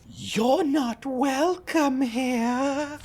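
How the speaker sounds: high, drawn-out eerie warning; slow, high spooky intoning; creepy voice. Acted. House warning off the intruder in a high, slow creepy voice.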